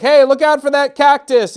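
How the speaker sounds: high, loud squeaky balloon warning. Acted. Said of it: "one of them's like".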